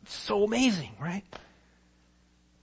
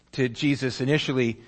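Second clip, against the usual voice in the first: usual speaking voice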